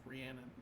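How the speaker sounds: "Rhiannon" as ree-AN-un, with a short "a" as in cat; mistaken pronunciation. Shown as a demonstration. Game master recalling their mistaken name for Rhiannon, mispronounced ree-AN-un. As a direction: say "Rhiannon" softly, middle rhyming with "ran". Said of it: "I was like".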